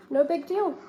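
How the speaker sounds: teacher repeats the grape to the class, easygoing; grape's easygoing voice. Shown as a demonstration. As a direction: echo the grape's easy forgiveness. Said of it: "the grape just says it's okay".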